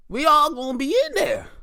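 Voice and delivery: high-pitched, punchy cheer; excited high-pitched declaration; high exclamation. Acted. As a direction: call it out high and eager. Repeats line two ways.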